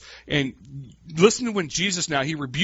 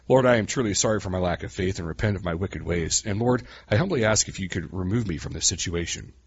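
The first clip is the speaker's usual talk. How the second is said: lower, stiff, overly pious prayer voice; mock formal prayer; 8 semitones lower than usual. Preacher mocking a long formal prayer, in a low pious voice. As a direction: read it like a long-winded holy prayer. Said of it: "Peter would have prayed something along these lines".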